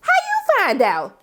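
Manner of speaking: high, slow gossipy surprise; nosy surprise. Retold or imitated. Recapper reacts to Nate news, high slow surprise. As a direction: gasp at juicy news. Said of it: "I was like".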